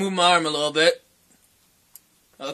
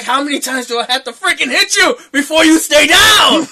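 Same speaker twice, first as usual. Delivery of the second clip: mock angry shout, higher-pitched; furious ex imitation; 5 semitones higher, 4 dB louder than usual. Crazed ex demanding he stay down, mock furious yell. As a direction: raise it loud as the retold attacker. Crazed ex yelling while hitting.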